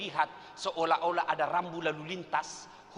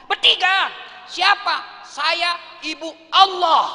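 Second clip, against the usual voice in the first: high loud insistent back-and-forth; heated exchange; 12 semitones higher, 13 dB louder, much slower than usual. Acted. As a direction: argue it out loudly. (debat keras bernada tinggi)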